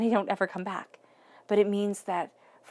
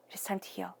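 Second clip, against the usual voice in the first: hushed, softer whisper; 9 dB quieter than usual. Real, not acted. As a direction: breathe it out as a gentle secret. Tarot reader softly affirms healing.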